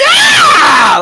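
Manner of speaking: high, loud witch shriek; witch scream. Acted. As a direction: shriek as the cart goes over. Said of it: "kind of screams".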